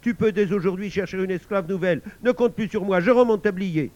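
high, loud tirade, building to the end; angry scolding. A narrator's reading. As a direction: hurl the resignation at him. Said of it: "gronda sa femme".

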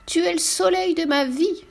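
high, tender grandma voice; cooing like a doting grandmother; loving grandmother. A narrator's reading. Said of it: "dit sa grand-mère".

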